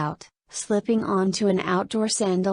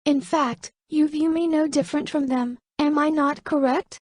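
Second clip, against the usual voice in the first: put-on higher, scornful young woman's voice; disgusted; 7 semitones higher than usual. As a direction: throw it back at him with distaste. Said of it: "with a disgusted look".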